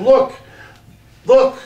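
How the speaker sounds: high, loud excited calling; excited shout. A narrator's reading. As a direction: call out thrilled to a friend. Lads spot the soldier.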